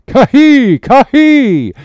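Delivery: high, loud two-note war cry; wild yell. A narrator's reading. Oarsman answering the wild screams, high yell. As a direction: yell it wildly at full voice. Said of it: "yelled Dago".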